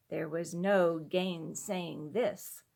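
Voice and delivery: ordinary delivery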